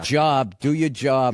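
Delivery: Boston accent, "job" like "jawb"; a Boston "do your jawb". Shown as a demonstration. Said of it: "just think like saw".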